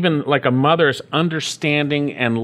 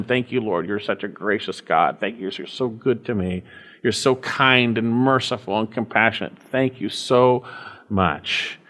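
softer, lower, intimate thanks to God; quiet prayer of thanks; 4 semitones lower than usual. Shown as a demonstration. Pastor models a grateful prayer, soft and low. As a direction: murmur it like a private prayer. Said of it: "repeat in our hearts and minds towards him".

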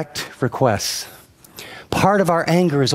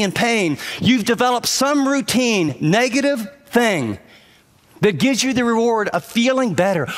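preaching; 7 semitones higher than usual. Acted. Pastor pressing the congregation about anger habits, rising preaching. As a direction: pitch climbs higher as the point builds.

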